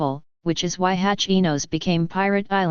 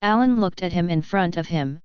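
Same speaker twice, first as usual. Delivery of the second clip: ordinary delivery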